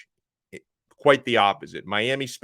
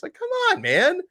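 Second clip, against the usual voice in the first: exasperated; 3 dB louder than usual. Real, not acted. Exasperated at a YouTube commenter.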